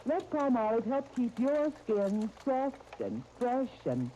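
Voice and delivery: earnest, persuasive ad pitch; soap commercial read. Acted. Announcer pitching soap to mothers, earnest and persuasive. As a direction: sell it gently to mothers.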